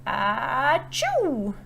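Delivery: big bear sneeze; loud sneeze. Acted. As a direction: sneeze it out loudly.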